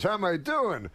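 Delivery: comic doing his Rodney impression for a talk-show host; Rodney Dangerfield. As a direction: do Rodney deadpanning his own punchline. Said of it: "And Rodney goes".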